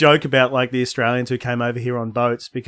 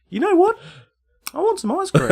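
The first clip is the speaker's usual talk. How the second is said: mock Pat. Mimicking Pat wanting ice cream.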